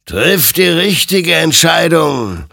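loud, high, drawn-out command; stern admonishing. A narrator's reading. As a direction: admonish forcefully, stretching the words. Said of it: "ermahnte ihn sein Gegenüber". (laut und gedehnt ermahnend)

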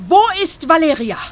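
loud, high demand; alarmed shout. Acted. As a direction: call out sharply for a missing girl. Alarmed on finding girl missing.

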